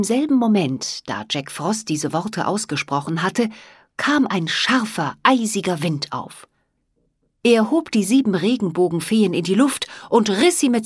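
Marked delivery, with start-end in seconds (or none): none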